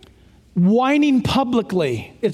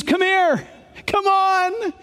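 high, urgent welcoming call; eager beckoning; 7 semitones higher, slower than usual. Acted. Jesus calling the sinner over to be received, eagerly beckoning. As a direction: call them in eagerly, pitch held high.

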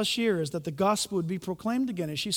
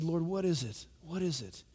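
hushed plea; 4 semitones lower, 10 dB quieter than usual. Retold or imitated. Lying face down praying.

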